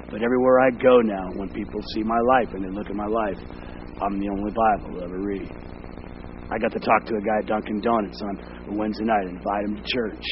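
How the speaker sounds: loud, driving sermon; full-force preaching. Acted. Pastor urging congregation to witness, loud. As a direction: preach it loud to the back row.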